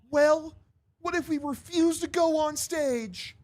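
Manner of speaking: high, slow put-on singer voice. Band threatens [name] with refusal, high slow voice. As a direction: push back in a high, drawn-out voice. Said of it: "the fucking Jonas Brothers say something".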